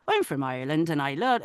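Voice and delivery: warm-up line in an Irish accent; put-on Irish lilt. Shown as a demonstration. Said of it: "like an Irish accent".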